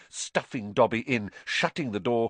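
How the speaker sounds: usual speaking voice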